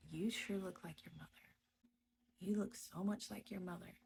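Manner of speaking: softly whispered mimicry of him; hushed retelling. Retelling the husband's repeated remark, hushed. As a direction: breathe his remark, hushed. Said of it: "he would say".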